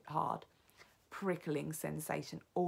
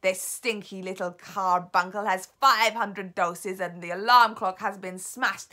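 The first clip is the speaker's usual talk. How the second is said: very slow, loud witch's yell; triumphant yell; 3 semitones higher, 13 dB louder than usual. Acted. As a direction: yell the number in triumph from far off. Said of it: "the voice of the Grand High Witch yelling".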